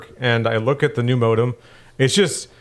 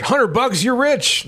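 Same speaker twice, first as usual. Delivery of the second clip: mock old-timer; 12 semitones higher, slower than usual. Mocking old-timer money talk.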